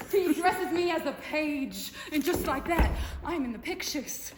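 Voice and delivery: vivacious film star reminiscing; lively, swinging from big boasts to fond asides; Mabel Normand. Acted. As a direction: tell it theatrically, bubbling into laughter.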